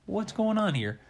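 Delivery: put-on startled girl character. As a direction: ask it high, in mock bewilderment. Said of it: "she looks at the duck and she's like".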